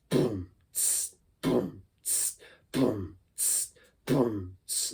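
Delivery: soft boom kick then hissed tss, looped; beatbox a boom-tss groove; boom tss beatbox pattern. Shown as a demonstration. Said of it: "put those two together".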